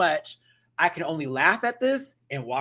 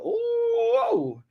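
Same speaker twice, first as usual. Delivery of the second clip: high, drawn-out mock gasp of delight; a big teasing ooh at the drama. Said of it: "I was like".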